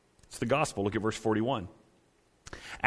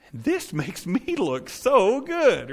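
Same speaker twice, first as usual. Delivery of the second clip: higher, slow, admiring drawl; pleased shopper; 6 semitones higher, much slower than usual. Retold or imitated. Shopper in front of a thinning mirror, slow and pleased. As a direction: coo at your reflection like a delighted shopper. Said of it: "you go".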